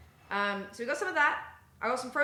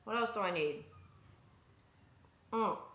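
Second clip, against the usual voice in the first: ordinary talk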